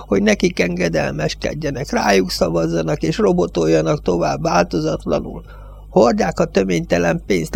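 an aged judge railing against the Yankees; old village judge. Acted.

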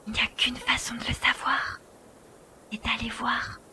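hushed, breathy whisper while sneaking in; whispering inside a stranger's house. Acted. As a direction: keep it to a whisper so no one hears. Sneaking into the dark dome.

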